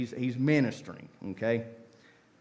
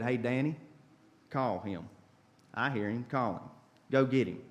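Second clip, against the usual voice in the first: softer, easy, friendly aside; quiet casual instruction; 3 semitones lower, 6 dB quieter than usual. Retold or imitated. Jesus quietly telling his friends to call him, softly. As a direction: tell a friend to fetch him. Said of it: "He looked at him and said".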